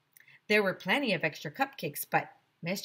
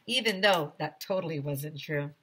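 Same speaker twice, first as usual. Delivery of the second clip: ordinary delivery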